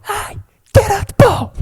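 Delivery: high, loud echo of a catchphrase; comic quoted shout. Retold or imitated. Echoing friend's cat-and-milk bit, high shout. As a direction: holler it at an imaginary cat.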